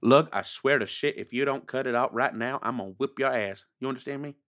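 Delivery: low, quiet, menacing warning; hushed threat. Acted. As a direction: threaten him under your breath. Threatens his brother off mic.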